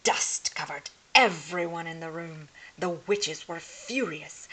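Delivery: plain reading voice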